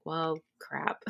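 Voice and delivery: unvoiced whispered letdown; whispered well crap. Whispering to herself at the empty office, deflated. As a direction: breathe it out, deflated.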